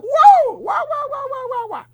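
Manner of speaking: squealing high excited wows, fading off; a burst of very high wows that trail away. Retold or imitated. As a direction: gush like thrilled Jamaican listeners. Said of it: "they were so excited".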